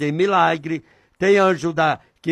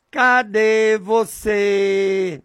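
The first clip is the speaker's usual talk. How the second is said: slow, sustained calling with long held vowels; long drawn-out call; 3 semitones higher, much slower than usual. Shown as a demonstration. Preacher shows listeners how he calls on God, drawn-out call. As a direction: call out to God, stretching each syllable slowly. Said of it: "Eu falo assim, desse jeito".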